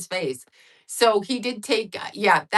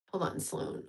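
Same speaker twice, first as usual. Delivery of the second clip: hushed aside, breathy and barely voiced; real off-mic whisper to a friend; quick whisper; 12 dB quieter than usual. Real, not acted. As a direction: whisper it off to the side so the audience can't hear. Off-mic aside to someone nearby.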